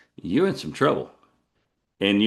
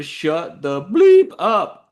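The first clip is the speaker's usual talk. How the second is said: slow, higher and louder, with a spoken bleep; loud retold outburst; 8 semitones higher, 7 dB louder, much slower than usual. Boyfriend fed up at the bar, loud mock outburst. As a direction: imitate a frustrated man's self-censored outburst. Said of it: "he said it loud".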